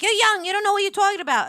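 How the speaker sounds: put-on mother dismissing her teen daughter, scolding fast; mom scolding. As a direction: brush her off sharply.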